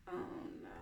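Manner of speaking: whispered mock worry; whispered dismay. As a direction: breathe it as a whisper.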